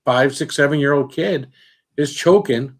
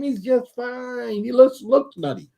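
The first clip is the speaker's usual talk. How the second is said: much higher, falsely breezy greeting; cheery greeting; 12 semitones higher than usual. Pretend. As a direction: act way too friendly to calm things. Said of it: "I'd be like".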